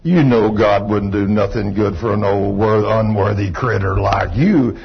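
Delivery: low, slow, dragged-out devil taunt; devil's voice. Acted. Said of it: "that devil said".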